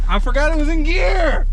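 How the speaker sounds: high, loud panicked yell; shouting. Real, not acted. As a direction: yell it out in alarm. Realizes mistake, repeats shouting.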